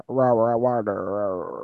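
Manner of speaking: low, growled caricature of American r's; American accent sent up as "rah rah" noise; mock American accent. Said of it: "No Americans".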